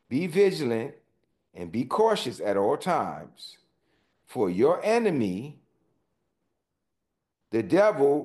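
deliberate, emphatic Bible reading; scripture reading. Acted. Pastor reading the verse to the congregation, emphatic. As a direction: read the verse slowly with weight.

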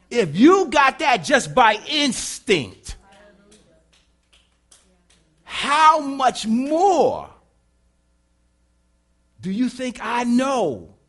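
slow, loud, high-pitched preaching; full-force preaching. Real, not acted. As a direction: hammer each phrase out, with weighty pauses.

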